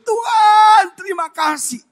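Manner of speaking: high, loud scream of thanks, easing off; jeritan syukur. Retold or imitated. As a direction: re-enact shrieking thanks to God. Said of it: "saya jerit". (menjerit syukur, melengking keras lalu mereda)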